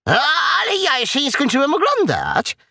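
high-pitched piglet whine; complaining piglet. A narrator's reading. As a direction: protest in a high, childish voice. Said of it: "poskarżył się Prosiaczek".

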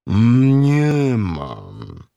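long-drawn cow voice; cow's curt refusal. A narrator's reading. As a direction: stretch it out like a moo. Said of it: "odparła krowa".

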